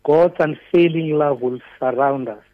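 ordinary delivery

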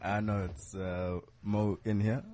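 low, soft, slow radio voice; slow and hushed, playing the radio host. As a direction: put on a laid-back radio voice, quietly. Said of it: "with my radio voice on".

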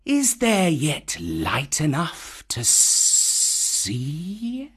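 slow snake voice with a long rising end; Kaa the python. Acted. Kaa questioning the monkeys, slow hypnotic python voice. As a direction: stretch the last word upward.

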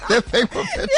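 words squeezed out through high laughter; laughing. Real, not acted. Co-host cracks up at her own lift-club joke, laughing high. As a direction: laugh your way through the punchline.

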